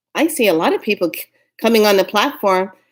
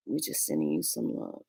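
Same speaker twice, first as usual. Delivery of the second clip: deep put-on voice; 14 dB quieter than usual. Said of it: "All right, I'm done doing that".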